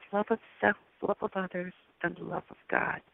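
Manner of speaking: very soft, sinking phrase by phrase; hushed quoted message. Retold or imitated. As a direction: drop almost to a hush, reverently quoting. Repeats the angel's solemn message.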